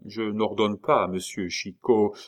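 usual speaking voice

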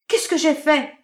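high, loud desperate cry. Acted. Marinette clinging to Benjamin's sleeve, desperate loud cry. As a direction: shout it clinging to him. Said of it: "cria Marinette".

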